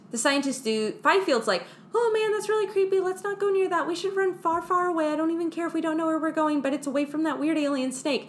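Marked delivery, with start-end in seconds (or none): 1.9-8.3 mock scared. Fifield voiced scared, high.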